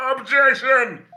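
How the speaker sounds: shouted objection. Acted. Attorney shouts in court.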